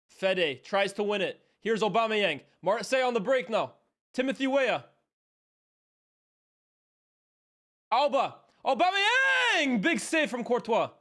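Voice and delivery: loud, rising football commentary; excited play-by-play. Real, not acted. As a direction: build to a high, drawn-out shout on the striker's name. Calling a live attack.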